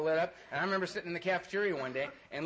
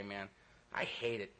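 ordinary delivery

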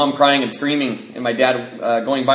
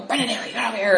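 higher, calling-out mother; scolding mom; 8 semitones higher, 4 dB quieter, faster than usual. Retold or imitated. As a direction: summon the kid from the bushes.

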